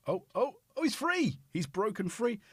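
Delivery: high, loud delighted cry; suddenly thrilled, calling it out; excited. Real, not acted. Someone breaks free on the stream, said with excitement.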